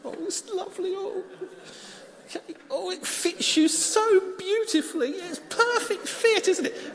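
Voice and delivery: high, loud delighted admiration; gushing praise. Real, not acted. Admiring child in coat.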